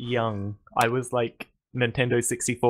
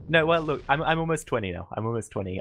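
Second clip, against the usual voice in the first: ordinary talk, nothing marked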